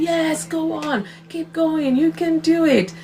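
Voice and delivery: high, cheering call from the sidelines; cheering shout. Retold or imitated. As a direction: yell it like a family member at the track. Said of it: "a family member on that field is shouting".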